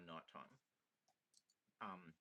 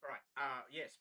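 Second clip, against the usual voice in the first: usual speaking voice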